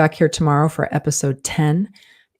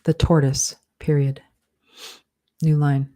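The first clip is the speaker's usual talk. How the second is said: slow dictation, punctuation spoken aloud; dictating; slower than usual. Shown as a demonstration. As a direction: dictate it word by word with pauses.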